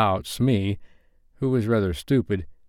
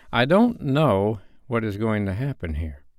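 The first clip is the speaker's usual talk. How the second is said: usual speaking voice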